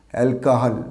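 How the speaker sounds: অ্যালকোহল as "al-ka-hal"; corrected pronunciation. Shown as a demonstration. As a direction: model অ্যালকোহল with an open "ka". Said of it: "Say with me". (সঠিক অ্যালকোহল, "অ্যাল-কা-হাল")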